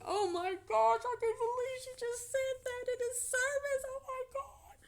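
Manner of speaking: high, far, gasping disbelief; mock the scandalised congregation; hushed high-pitched churchgoer shock; shocked onlookers. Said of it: "People looking around like".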